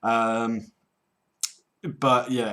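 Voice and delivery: ordinary delivery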